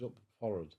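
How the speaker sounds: "forward" said as "forrard"; sailor's clipped "forward", pronounced FORR-ud; nautical forrard. Retold or imitated. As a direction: say "forward" without the w, as FORRUD. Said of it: "Oh, you mean forward".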